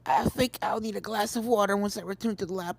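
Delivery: a touch of a rough voice after coughing. Acted. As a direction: speak a little rough, throat sore from choking. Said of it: "talking with a rough voice".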